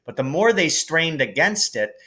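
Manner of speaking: plain reading voice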